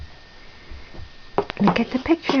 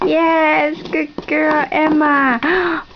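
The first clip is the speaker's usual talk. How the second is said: high, drawn-out cheering praise; excited praise; 5 semitones higher, much slower than usual. Real, not acted. Cheers toddler's correct answer.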